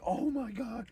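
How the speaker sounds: high-pitched awestruck shrieks; excited fan squeal. Retold or imitated. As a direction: gush like a teen gamer watching the clutch. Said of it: "they were like".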